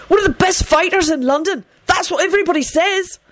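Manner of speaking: high-pitched, anxious gushing; frantic. A narrator's reading. Benji praises the dead fighter to captors, frantic. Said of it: "Benji yelled frantically".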